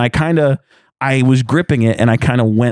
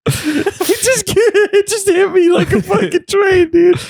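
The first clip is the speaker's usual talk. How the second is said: very high, breathless words in a laughing fit; slower than usual. Real, not acted. Explaining to friends why the joke hit, laughing hard. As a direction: wheeze it out mid-laugh.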